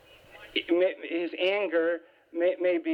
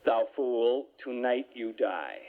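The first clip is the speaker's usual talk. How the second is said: lower, slow doom voice; angel of death; 7 semitones lower, slower than usual. Retold or imitated. Angel of death telling the rich farmer his fate, low and slow. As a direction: pronounce the sentence low and slow. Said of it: "the angel of death comes and taps".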